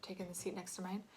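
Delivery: usual speaking voice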